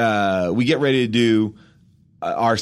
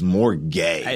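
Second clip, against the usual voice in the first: quoted back; 4 semitones lower than usual. Retold or imitated. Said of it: "The one that was most offensive was".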